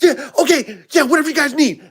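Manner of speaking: high, hurried eager yes; frazzled eager waitress voice; flustered waitress. Retold or imitated.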